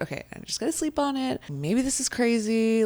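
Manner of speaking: high, fast, talking yourself down through excitement; giddy self-restraint. Retold or imitated. Talking herself down at eleven at night, high and fast. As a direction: rein in the thrill, still bubbling high. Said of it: "But I'm like".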